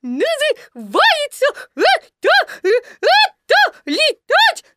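very high, slow squeaky little piglet; прыгающие мысли Пятачка. A narrator's reading. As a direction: squeak it jolt by jolt, as if bounced in a pocket. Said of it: "в голове у него прыгали такие мысли". (писклявый голос, слова вприпрыжку)

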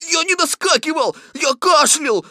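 high, loud, obstinate protest; emphatic high-pitched tiger denial; stubborn tiger. A narrator's reading. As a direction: cut in and insist stubbornly. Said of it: "сказал тигр упрямо".

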